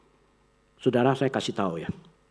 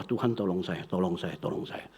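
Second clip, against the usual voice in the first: softer, lower pleading prayer; softer, lower murmured plea to God; desperate prayer; 6 semitones lower, 8 dB quieter than usual. Retold or imitated. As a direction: pray under your breath for help.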